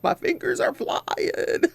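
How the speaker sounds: high, breathless, laughing mimicry; mock frantic stenographer. Court reporter complaining about typing, high laughing mock voice. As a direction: squeak it out through laughter. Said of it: "She's like".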